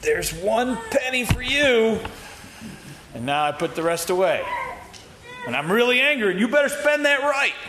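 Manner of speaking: mock grudging giver, pitch climbing high. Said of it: "Don't give grudgingly and say".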